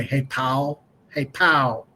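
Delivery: country dad saying Paul as "pal"; drawn-out country vowel in "pal"; dad's West Virginia pronunciation. Retold or imitated. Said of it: "instead of saying Paul, he would say".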